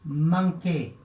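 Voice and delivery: "manquer" said as French "mankê", one K sound; French demo word; French pronunciation. Shown as a demonstration. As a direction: model "manquer" with a hard K. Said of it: "Por exemplo".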